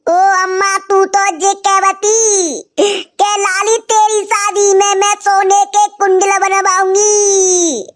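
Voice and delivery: keen and wail like a village mourner, overdone; mock lament. Hammed-up lament for dead mother.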